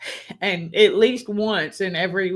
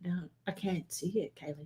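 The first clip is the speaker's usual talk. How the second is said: much softer, almost trailing away; quiet puzzled reply; 15 dB quieter than usual. Retold or imitated. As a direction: murmur it, confused and amused. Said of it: "I'm like".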